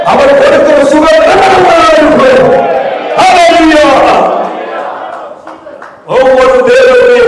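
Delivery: fiery pulpit exhortation; full-force preaching. Acted. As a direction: preach it hard and climb to a shout.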